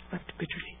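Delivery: unvoiced, hushed repeat of the phrase; whispered, grieving echo. Real, not acted. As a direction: breathe it out like a sorrowful aside. Echoes scripture phrase.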